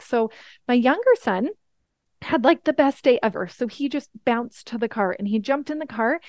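ordinary talk

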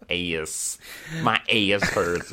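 put-on a lazy Philly accent, long a in ass; mock Philly accent. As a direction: drag ass out the Philadelphia way. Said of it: "Philadelphia accent".

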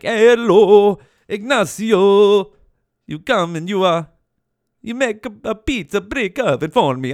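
mock Italian accent. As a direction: ask the Italian builder for a favour in a cartoonish Italian accent. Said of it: "I sound like fucking Mario right now".

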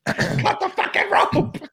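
high, strained, exasperated scream; slow hoarse yell at the screen. Retold or imitated. Viewer pleading with characters to act, screaming. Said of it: "I am screaming".

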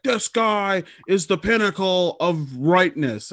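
high, slow mock proclamation; hammed-up reverent declaration; awestruck follower. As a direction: proclaim it grandly, stretching each word. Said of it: "and they're like".

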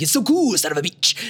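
high, hammed-up cocky dub line; imitating dub actor. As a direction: mimic the voice actor's flourish. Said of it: "Jay Michael Tatum be like".

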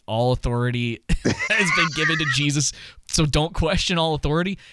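quoting through a laugh; laughing mock quote. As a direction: paraphrase it while laughing. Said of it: "that statement is".

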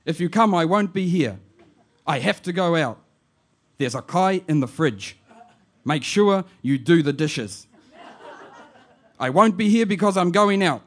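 put-on chatty old auntie on the phone; lightly voiced auntie. Auntie leaving message.

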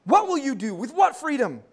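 put-on loud, high William Wallace rally cry, no accent; rally the army to fight for freedom; rousing battle speech. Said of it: "when it says".

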